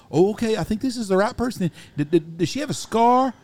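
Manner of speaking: hammed-up prank caller probing; mock prank caller. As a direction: drag it out high like a sly caller. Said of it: "He says".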